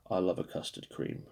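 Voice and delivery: soft whispered confession of love; breathy whisper. Real, not acted. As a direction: whisper it like a fond secret. Softly confessing love for biscuit.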